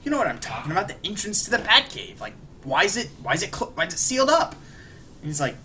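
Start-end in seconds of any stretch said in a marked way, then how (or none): 0.0-4.5 rising higher and higher in disbelief, fast at first; mock-panicked questions building to a squeak; baffled. Bruce baffled the Batcave is sealed.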